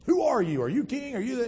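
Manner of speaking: high, insistent interrogation; peppering questions. Acted. Pilate pressing a silent Jesus, high insistent peppering. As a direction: fire questions at someone who won't answer. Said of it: "peppering him with questions".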